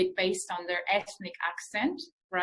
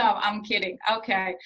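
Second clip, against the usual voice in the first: normal talking voice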